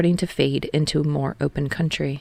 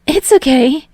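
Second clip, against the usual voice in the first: Hinata's voice; 9 semitones higher, 8 dB louder than usual. A narrator's reading.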